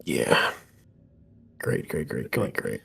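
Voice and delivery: quiet deflated whisper; resigned whisper. Real, not acted. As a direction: mutter it under your breath in defeat. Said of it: "player whispers resigned".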